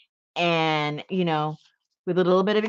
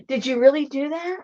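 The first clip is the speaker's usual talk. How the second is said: usual speaking voice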